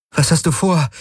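high-pitched, concerned woman's voice; worried question. A narrator's reading. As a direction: ask with a touch of worry. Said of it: "hörte er Kirai besorgt fragen".

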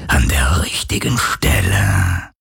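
very low, slow whispery ghost; unconcerned phantom. A narrator's reading. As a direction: let it sink into a deep, drawn-out spirit voice. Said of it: "sagte das Phantom unbekümmert". (tiefe, gedehnte, hauchende Phantomstimme)

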